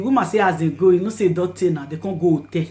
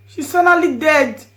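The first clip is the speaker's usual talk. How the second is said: anguished grief; 7 semitones higher, 7 dB louder than usual. Real, not acted. Grief outburst.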